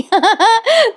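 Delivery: high burst of giggling; short high amused laugh; real laugh. Real, not acted. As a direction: giggle out at your own comparison. Laughs at film comparison.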